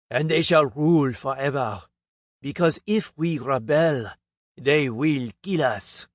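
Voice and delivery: put-on a pompous Frenchman revealing alien bloodlines, French accent. A narrator's reading. French speaker telling the conference crowd, heavy French accent. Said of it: "In his French accent".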